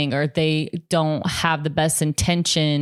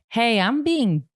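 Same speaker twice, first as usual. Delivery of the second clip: high, slow imitation of a younger self; younger self pleading; 6 semitones higher, slower than usual. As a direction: act out trying to get adults' attention, pitched up. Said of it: "when I was younger and I was trying to tell people".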